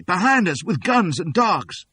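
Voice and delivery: slow, high, urgent warning; crying out. A narrator's reading. Fleeing woman warning the townsfolk, slow high cry. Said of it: "cries Cassie".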